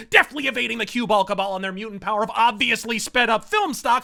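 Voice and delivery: usual speaking voice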